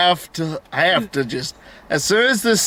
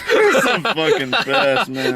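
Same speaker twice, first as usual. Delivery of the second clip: laughing; 6 semitones higher, slower than usual. Retold or imitated. Laughing while quoting a character.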